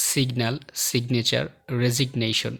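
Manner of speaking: "সিগন্যাল, সিগনেচার, রেজিগনেশন" with a hard G before N; sounded G. Shown as a demonstration. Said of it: "জি উচ্চারিত হয়েছে".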